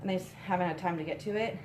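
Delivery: ordinary talk, nothing marked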